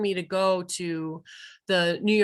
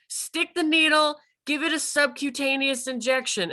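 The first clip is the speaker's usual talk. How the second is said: urgent command; 6 semitones higher than usual. Retold or imitated. Mentor urgently ordering injection.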